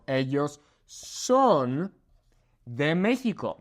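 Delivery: "son" drawn out slowly with a pause before "de México"; slow stress on son. Shown as a demonstration. Teacher filling in the blank for students, slow on "son". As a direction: stretch "son", then pause. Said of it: "They are of Mexico".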